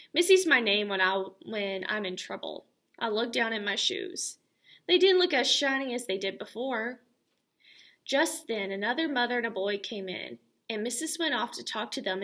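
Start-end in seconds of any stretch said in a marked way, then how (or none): none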